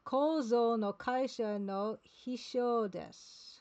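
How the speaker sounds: "Kozo no kaisha no hisho desu" said slowly and evenly; slow, careful Japanese recitation; careful slow reading. Shown as a demonstration. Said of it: "let me say it".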